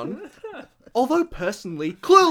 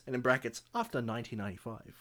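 quiet aside; 8 semitones lower, 10 dB quieter, faster than usual. Acted. Parenthetical aside while reading list.

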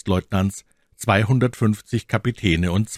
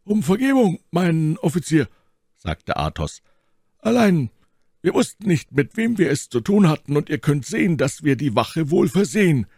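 polite explanation; 10 semitones higher than usual. A narrator's reading. Athos apologises to officer.

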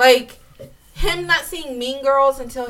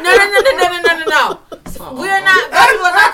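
loud, high denial with some laughter; insistent protest; 7 semitones higher, 8 dB louder, faster than usual. Real, not acted. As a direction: loudly object to friends' teasing, half laughing.

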